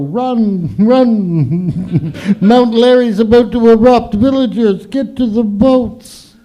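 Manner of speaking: high, loud mock evacuation call; disaster alarm. Comic warning to villagers before the eruption, loud and high. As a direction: ham it up like a volcano warning. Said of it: "so I've gotta go".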